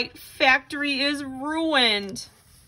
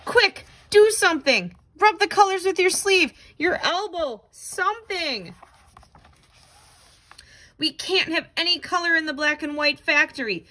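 higher, frantic urging; panicky; 5 semitones higher than usual. Acted. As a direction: scramble as if the mess is spreading. Color spreading, urgent panic.

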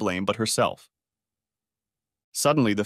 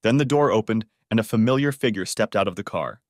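ordinary delivery, nothing marked

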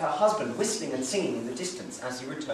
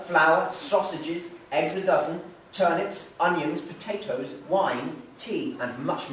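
usual speaking voice

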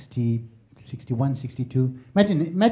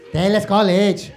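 Malaysian phone chatter; 6 semitones higher, 6 dB louder, slower than usual. Retold or imitated. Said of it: "Malaysians were on the phone going".

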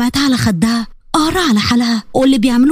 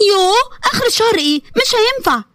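whiny protest; 9 semitones higher than usual. Acted. Sister whining over allowance ban.

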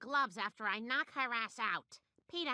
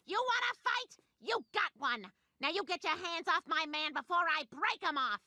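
high-pitched angry outburst; furious challenge; 4 semitones higher, slower than usual. Acted. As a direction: snap and take on the bully. Wife snaps and accepts the fight.

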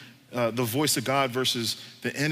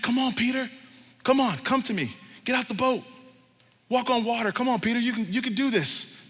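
high, insistent calling; urgent coaxing; 6 semitones higher than usual. Acted. God calling Peter out of the boat, coaxing. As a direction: urge them to step out and come.

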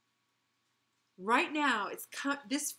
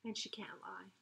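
whisper; 8 dB quieter than usual. A narrator's reading. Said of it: "whispered aside".

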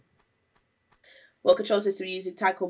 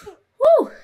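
excited whoop; 13 dB louder than usual. Real, not acted. Jumping in game.

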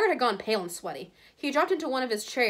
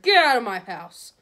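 loud, slow, forceful command; booming, drawn-out anger; angry order; 3 semitones higher, 9 dB louder than usual. A narrator's reading. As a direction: throw him out of your house. Hagrid orders Malfoy out.

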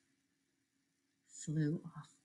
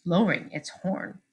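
ordinary delivery

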